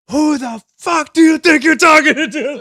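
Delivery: high, very loud outraged yell; re-enacted screaming. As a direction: scream it at him, acting out the fury. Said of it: "I'm just screaming at this guy".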